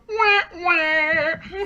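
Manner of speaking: high, whining wah wah sound effect; imitating a pathetic comedic whine; mock whiny wah wah. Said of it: "you can almost hear him go".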